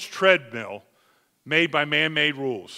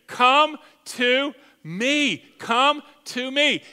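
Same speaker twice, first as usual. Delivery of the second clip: slow tender invitation; 6 semitones higher, 4 dB louder, much slower than usual. Acted. Slow repeated quote of Jesus.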